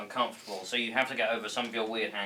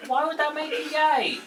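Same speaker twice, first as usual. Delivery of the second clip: much higher, incredulous question; 8 dB louder than usual. Acted. Mate questioning Adam's outburst, high incredulous voice. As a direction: squeak the question in disbelief.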